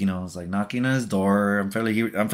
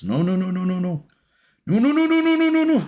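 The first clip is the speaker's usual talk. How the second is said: rapid-fire high squeal, climbing higher and louder; real playful panic; 9 semitones higher, 3 dB louder than usual. Real, not acted. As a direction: squeal out a string of "no"s as it slips away. Something going wrong as he plays.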